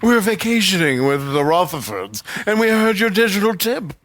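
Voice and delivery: hammed-up British high society voice; pretend smart British. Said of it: "we're British".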